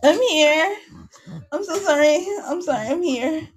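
high, apologetic character voice; Katie. Acted. Character apologising to co-hosts, high put-on voice. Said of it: "Katie's no longer here anymore".

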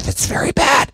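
whispered, scandalized mom. Retold or imitated. Mom scolding her son, whispered disapproval. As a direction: hush it like a shocked mother. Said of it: "She's like".

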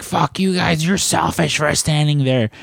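high, indignant yelling Karen imitation. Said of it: "that was my Karen voice".

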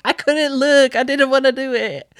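very high squeaky mimicry; mock whimpering girlfriend. Girlfriend excusing herself after not watching, mock squeal. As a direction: squeal it as a comic imitation.

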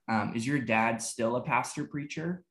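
ordinary delivery

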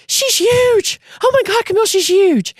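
imitating husband's high, loud amazement; amazed outburst. Husband tells wife how big the newborn looks, amazed outburst. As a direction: exclaim it high, stretching HUGE.

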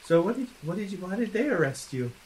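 ordinary talk, nothing marked

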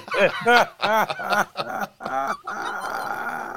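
high, drawn-out laughing fit; real helpless laughter; long laugh. Real, not acted. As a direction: burst out laughing and keep it going. Reacting to friend's story.

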